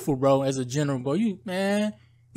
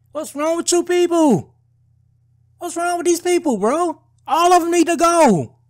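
raised, loud, furious yelling; angry shout; 10 semitones higher, 5 dB louder than usual. Real, not acted. Vlogger yelling at leaders in open fury. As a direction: shout out your outrage at them.

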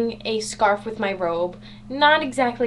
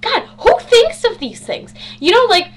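excited outburst; 8 semitones higher, 7 dB louder than usual. Real, not acted. Excited rant about inventions.

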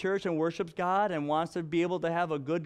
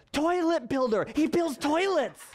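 put-on high and louder, little girl blurting it out; daughter's eager answer; 9 semitones higher, 4 dB louder than usual. Father imitates daughter naming the groom's job, high excited child voice. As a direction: chirp it like an excited small child. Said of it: "Ziva said".

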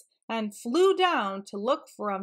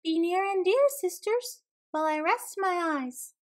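high, lilting little-dassie call; high small-animal voice; 8 semitones higher than usual. A narrator's reading. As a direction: sing out high to the sisters, then ease down. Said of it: "called out".

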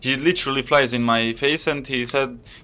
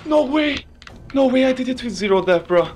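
higher, thrilled disbelief; can't believe the win; excited disbelief; 11 semitones higher than usual. Real, not acted. Disbelieving triumph.

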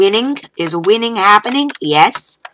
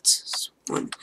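whispering; 11 dB quieter than usual. Real, not acted. Showing off the bought friend.

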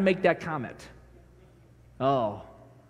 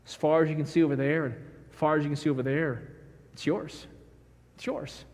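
softer and softer, intimate; gentle father; 5 semitones lower, 8 dB quieter than usual. Acted. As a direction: let the last words fall to a hush. Father showing inheritance to son.

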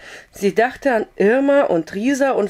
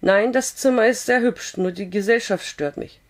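ordinary delivery, nothing marked

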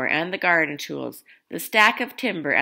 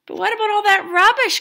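much higher, louder shocked question; gasping; 5 dB louder than usual. A narrator's reading. Grandma seeing nothing thrown away, high shocked gasp. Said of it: "gasped Grandma".

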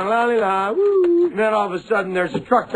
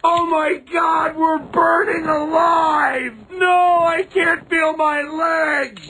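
high, slow anguished wailing screams; burning scream; 11 semitones higher, much slower than usual. Acted. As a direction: wail like you're burning alive. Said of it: "your family's screaming".

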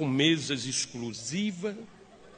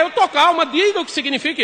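higher, louder yelling "I'm calm"; furious shout; 10 semitones higher, 8 dB louder than usual. Retold or imitated. Wife to husband at the cabaret, furious shout. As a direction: shout that you're perfectly calm.

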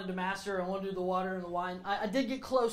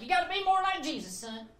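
higher mock mom voice; imitating mom; 9 semitones higher than usual. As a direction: nag like a churchy mom. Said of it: "my mom's like".

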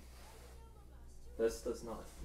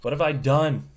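dismayed outcry; 4 semitones higher, 19 dB louder than usual. Real, not acted. Dismayed at a mess on camera.

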